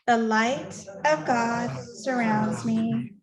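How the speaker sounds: very slow, measured prayer recitation; solemn, drawn-out communal affirmation; recited group prayer. Acted. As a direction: lead the room through it phrase by phrase, unhurried. Leads congregation in prayer.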